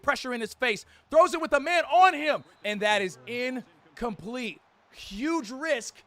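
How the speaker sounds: excited TV game commentary; play-by-play. Acted. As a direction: call the play like a broadcaster.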